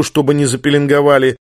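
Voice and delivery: plain reading voice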